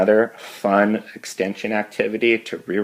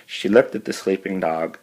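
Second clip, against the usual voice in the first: plain reading voice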